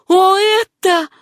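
high, loud, drawn-out exclamation; slow, soaring cry of amazement; excited cry. A narrator's reading. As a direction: cry out, overcome with joy. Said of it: "крикнула она".

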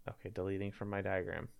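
normal talking voice

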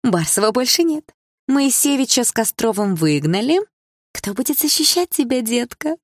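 high-pitched taunting; gloating mean girl. A narrator's reading. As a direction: crow over a defenceless rival. Rival girl gloats over heroine. (злорадная насмешка)

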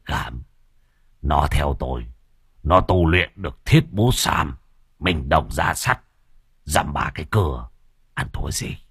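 giọng trầm hơn, khoe khoang tự đắc; nói như lão già khoác lác; lão Hậu khoe khoang. Acted. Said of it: "giọng của lão Hậu ở góc vườn vang lên". (lão Hậu trầm giọng khoe khoang)

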